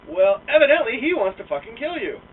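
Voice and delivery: loud, high burst of gossip; gleeful retelling. Retold or imitated. Coworker breaking the news loudly. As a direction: blurt out the juicy news.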